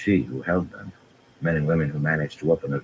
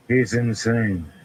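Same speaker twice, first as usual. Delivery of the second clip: cool; 7 semitones higher, slower than usual. A narrator's reading. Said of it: "she said coolly".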